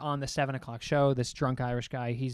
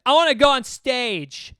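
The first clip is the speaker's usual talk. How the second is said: higher, louder, slow slurring demand; drunk woman; 8 semitones higher, 7 dB louder, slower than usual. Retold or imitated. A drunk patron to the host, a high slow whine. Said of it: "she is so drunk".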